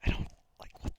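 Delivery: ASMR whisper into the mic; unsure, breathy-free hushed whisper. Real, not acted. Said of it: "Do you just whisper into the mic?".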